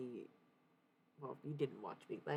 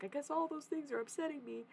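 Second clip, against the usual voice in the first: high, upset re-enacted whine; stressed venting; 12 semitones higher than usual. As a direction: imitate venting in a small high voice. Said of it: "unload all my stress and be like".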